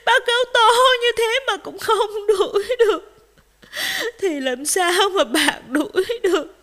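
high, loud tearful wail; words broken by acted sobs; sobbing. A narrator's reading. Rabbit doubts rooster can help, sobbing. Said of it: "thỏ vẫn không thôi nức nở".

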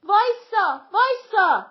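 very loud, high-pitched repeated holler; shouting. A narrator's reading. Women passengers shouting at driver, loud holler. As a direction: yell for the bus to stop. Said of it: "بقیهٔ زنها داد زدند".

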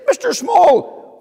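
high, loud and slow; startled cry at the door; a man shocked to find a tiny visitor; surprised shout. Acted. Robinson startled at the doorstep.

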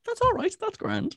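playing a laid-back Irish Garda in an Irish accent; mock Irish accent. Joking about the lone Garda, in a mock Irish accent. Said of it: "didn't do an Irish accent right then".

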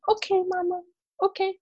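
put-on calm mama down like a small child; soothing toddler voice. Daughter comforting her mother, toddler voice.

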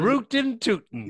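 high, slow cowboy catchphrase. Pretend. Friend joining the yeehaw joke, in cowboy voice. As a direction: stretch it out high like a cartoon cowboy.

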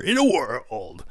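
slow, dramatic trailer narrator; movie trailer voice. Pretend. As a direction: drag out "in a world" like a trailer. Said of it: "movie trailer voiceover".

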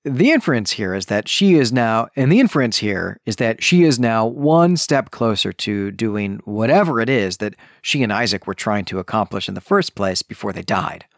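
ordinary talk

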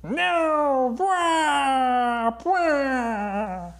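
high villain cry of defeat; Skeletor wailing. Acted. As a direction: wail like a foiled cartoon villain.

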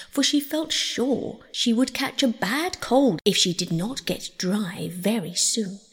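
plain reading voice

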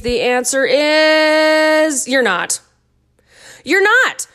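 slow build, then "not" rising high and loud; dramatic reveal. Shown as a demonstration. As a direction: land the reveal after a drum roll. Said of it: "Drum roll, please".

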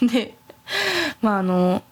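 ordinary delivery

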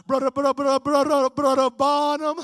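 loud stutter, then labored speech; Charlie Wycliffe. Retold or imitated. Evangelist at the pulpit asking for a reading, stuttering impaired speech. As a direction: stammer hard on the first word, then force out the request. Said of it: "Charlie stood up to the pulpit and he said".

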